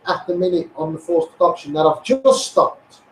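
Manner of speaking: ordinary delivery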